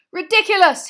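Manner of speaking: high, very loud spell cry; full-force incantation yell; determined shout. A narrator's reading. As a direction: shout it boldly, no hesitation. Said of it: "he shouted".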